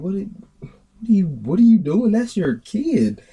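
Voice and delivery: high-pitched, stretched-out disbelief; incredulous protest. Retold or imitated. Onlooker reacting to a mother dragging her kid, incredulous.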